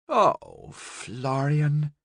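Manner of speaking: a mild, let-down sigh of a name; lightly disappointed aunt. Acted. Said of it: "she said in a disappointed tone".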